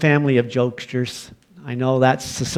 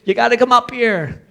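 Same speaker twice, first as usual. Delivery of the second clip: hollering; 10 semitones higher than usual. Retold or imitated. Frightened boy hollering down the stairs to mom, high yell. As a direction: yell for mom like a scared kid. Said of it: "hollering down".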